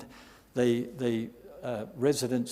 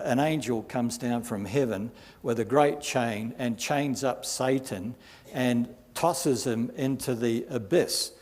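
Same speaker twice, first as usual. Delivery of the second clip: ordinary talk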